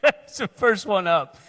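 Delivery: laughing loudly through the line; can barely get it out for laughing. Real, not acted. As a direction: laughs through the memory, slowed. Laughing at own story.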